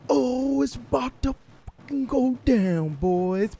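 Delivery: very high, loud hype yell; hyped. Real, not acted. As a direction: yell it like the big moment is here. Hyped for coming battle.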